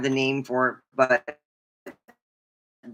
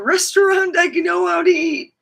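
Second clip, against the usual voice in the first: excited laughing boast; 6 dB louder than usual. Real, not acted. Laughing boast about table manners.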